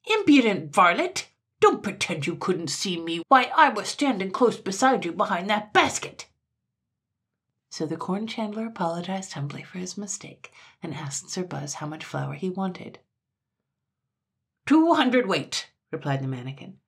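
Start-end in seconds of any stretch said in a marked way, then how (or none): 0.0-6.3 loud, high, furious scolding; incensed little tyrant; violent rage. A narrator's reading. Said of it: "he flew into a violent rage". 14.6-15.7 slow, high, loud declaration; imperious. A narrator's reading. Imperious mannikin names his order.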